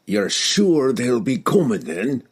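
a dwarf asking, slow and gruff; lightly voiced old dwarf. Dwarf Bruner asks.

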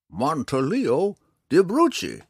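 high, loud, slow full-name flourish; proud old man. A narrator's reading. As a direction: roll out the grand name proudly. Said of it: "explained proudly".

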